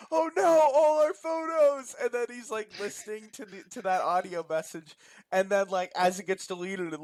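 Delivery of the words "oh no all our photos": high, slow hammed-up wail over lost photos; mock panic. Mocking the husband watching his wife's photos vanish, hammed-up panic.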